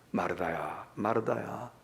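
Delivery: low, soft call of the name. Acted. Jesus looking at Martha, calling softly. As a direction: call her name tenderly, quietly. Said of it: "꾸짖는 ... 사랑스러운 눈빛일 수".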